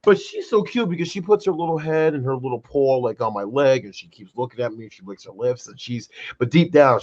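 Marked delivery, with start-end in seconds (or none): none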